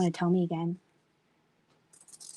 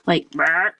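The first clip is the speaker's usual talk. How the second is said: burp-voiced word, louder; 3 semitones lower, 6 dB louder than usual. Shown as a demonstration. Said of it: "That was my imitation".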